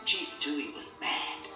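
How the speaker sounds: ordinary delivery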